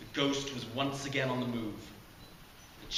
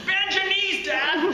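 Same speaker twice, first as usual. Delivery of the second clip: high and loud, half laughing; calling out; 11 dB louder, slower than usual. Acted. Jared shouting advice at falling Dad, loud and laughing. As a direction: yell a frantic tip across the hall.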